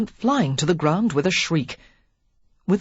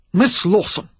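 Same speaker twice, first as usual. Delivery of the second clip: a loud, angry snap of her name; the earl snaps at the eavesdropper, angry; sharp snap; 3 semitones higher, 6 dB louder than usual. A narrator's reading. The earl catches Lily hiding, angry snap. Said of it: "he snapped".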